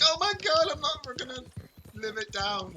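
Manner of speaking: high, hammed-up wail of agony; mock a footballer wailing over a tiny tap; whining injured footballer. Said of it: "and he's like".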